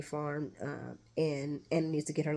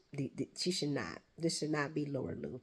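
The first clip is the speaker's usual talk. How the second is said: ordinary talk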